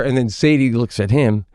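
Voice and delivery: ordinary talk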